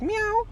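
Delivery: very high, short meow; imitated cat meow. Man pretends to be a cat, high meow. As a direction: squeak out a fake cat call.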